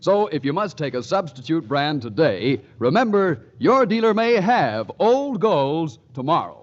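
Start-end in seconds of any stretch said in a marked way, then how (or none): none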